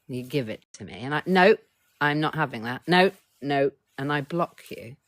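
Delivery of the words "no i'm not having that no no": much louder, pushing the offer away; loud refusal. Acted. Refusing a friend's gift or compliment, loud flat refusal. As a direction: shove it back loudly, no no.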